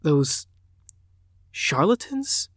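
father realising who his daughter protects, incredulous. A narrator's reading. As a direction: voice leaps up high in disbelief. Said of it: "he whispered".

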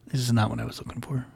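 quiet, deflated letdown; disappointed. Retold or imitated. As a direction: say it low, let down. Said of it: "Just real disappointed".

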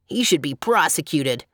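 understated gloom in a clown's voice; lightly voiced glum clown; gloomy clown. A narrator's reading. Clown grumbling about the artist, mildly gloomy. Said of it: "remarked the clown gloomily".

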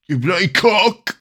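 hammed-up British jeer; British accent. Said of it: "in a British accent".

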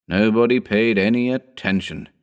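plain reading voice

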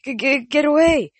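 high, loud, stuttering scream; stammering terror. A narrator's reading. Chris backing into the corner, terrified stammering scream.

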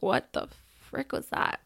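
whispered disbelief under her breath; stunned whisper. Retold or imitated. Watching him toss the wet cat, a shocked whisper. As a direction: mutter it in shock, barely voiced.

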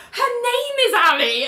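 high-pitched, hammed-up revelation; dramatic realisation. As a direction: announce the coincidence with mock wonder. Character's dramatic realisation, acted out.

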